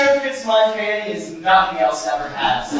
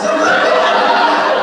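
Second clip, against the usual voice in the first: a loud, deep booming laugh; hearty laugh; 13 semitones lower, 7 dB louder than usual. Acted. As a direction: laugh out loud at your own joke. Big laugh after joke.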